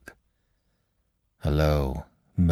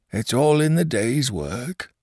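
hoarse; 8 semitones higher than usual. Acted. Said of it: "said Mister Levitt hoarsely".

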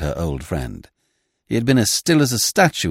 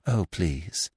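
deprecating; 3 semitones lower, 8 dB quieter than usual. A narrator's reading. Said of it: "said Miss Peavy deprecatingly".

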